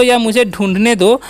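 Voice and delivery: ordinary delivery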